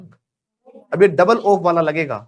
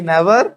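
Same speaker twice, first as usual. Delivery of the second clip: NEVER said a little louder and rising; stressed never; 5 dB louder than usual. Shown as a demonstration. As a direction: lean on Never. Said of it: "कैसे बोलेंगे?".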